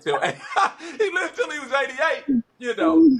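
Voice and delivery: laughing through the words; amused laughter breaking up the line. Real, not acted. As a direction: chuckle it out, voice climbing high. Laughs recalling grandfather smoking.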